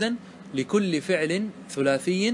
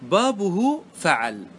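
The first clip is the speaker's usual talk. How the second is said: slow, chanted reading of a line of verse; slow verse recitation; 3 semitones higher, much slower than usual. Shown as a demonstration. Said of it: "قال الناظم".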